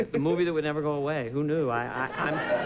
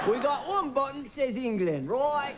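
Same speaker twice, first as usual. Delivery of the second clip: higher-pitched mock English pilot; English pilot accent; 6 semitones higher, slower than usual. English pilot reassures a passenger, high mock English accent. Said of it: "These three English guys are up in the cockpit".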